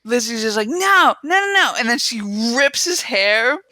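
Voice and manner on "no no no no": high, insistent string of refusals; mock protest. Lizzie disputing the dyed hair, mock protesting. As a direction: imitate a girl refusing to believe it.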